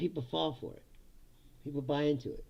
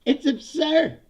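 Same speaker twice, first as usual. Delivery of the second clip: exasperated; 10 semitones higher, 9 dB louder, slower than usual. Real, not acted. Exasperated verdict.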